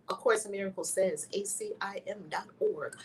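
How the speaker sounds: ordinary talk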